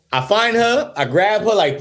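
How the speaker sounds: normal talking voice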